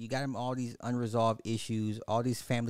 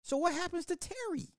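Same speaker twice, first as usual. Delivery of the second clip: much higher, incredulous at the ending; baffled. Real, not acted. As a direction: squeak out the disbelief. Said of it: "I'm like".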